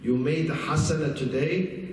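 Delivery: normal talking voice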